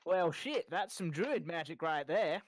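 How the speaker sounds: hammed-up Southern US twang, swooping pitch; bad Southern accent. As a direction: do a terrible Southern accent, loud and lilting. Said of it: "That was the worst Southern accent I ever heard".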